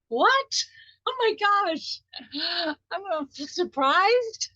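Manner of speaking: a sudden high gasp of astonishment; acted surprise. Coach acting a listener stunned by the money, high and surprised. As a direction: act out being floored by good news. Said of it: "you might be surprised".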